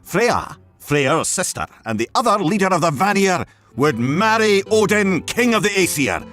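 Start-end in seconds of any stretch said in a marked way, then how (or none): none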